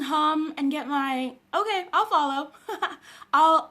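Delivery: ordinary talk, nothing marked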